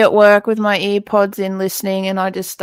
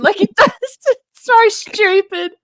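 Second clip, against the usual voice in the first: laughing; 11 semitones higher than usual. Real, not acted. S1 laughing about the clog insult, giggling through the words. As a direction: crack up while talking.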